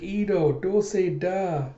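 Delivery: put-on high, slow little child voice. Little girl greets Ito, in a high slow child voice. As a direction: talk like a small girl. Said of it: "the little child comes up".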